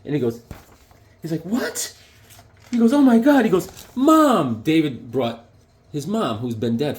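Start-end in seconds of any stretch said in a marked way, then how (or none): 1.4-1.9 stunned question. Acted. Jack stunned by surprise. 2.9-4.6 high, loud, astonished cry; stunned amazement. Acted. Jack sees his surprise in the dream, stunned cry.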